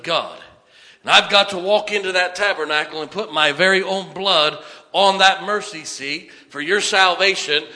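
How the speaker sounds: loud, high, insistent proclamation; Jesus, preached urgently. Acted. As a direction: press each phrase home, quickening at the end.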